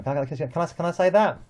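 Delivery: ordinary talk, nothing marked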